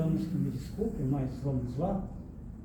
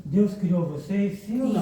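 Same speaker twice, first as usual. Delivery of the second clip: firmer, louder ask; 3 semitones higher, 6 dB louder than usual. Shown as a demonstration. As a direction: ask it again with real force. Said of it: "não senti firmeza".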